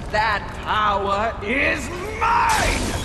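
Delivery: triumphant shout. Acted. Envy gloats to the Horseman, slow triumphant shout. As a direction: bellow it as your victory.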